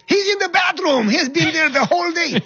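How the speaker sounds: high, loud soldier shout; shouted report. Retold or imitated. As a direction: yell it back like a soldier. Soldiers answering the lieutenant, shouted.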